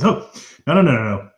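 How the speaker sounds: a nervous hall keeper begging for peace; flustered host. Acted. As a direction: rush to calm the hall down. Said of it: "the other man stands up and he goes".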